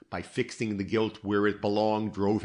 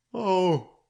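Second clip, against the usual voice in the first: sudden high loud "Oh!"; startled outburst; involuntary burst; 10 semitones higher, 6 dB louder than usual. A narrator's reading. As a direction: let it escape before she can stop it. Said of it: "she exclaimed in one involuntary burst".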